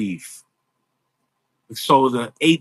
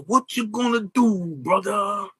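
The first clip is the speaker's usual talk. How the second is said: higher, growled catchphrase; Hulk Hogan impression; 6 semitones higher, slower than usual. Doing Hulk Hogan's catchphrase for the host, growled. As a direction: bark it out like the wrestler. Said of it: "I used to do Hulk Hogan".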